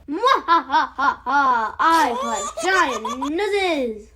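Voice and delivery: slow, gloating cartoon villain; evil villain laugh. Playing a nose thief villain.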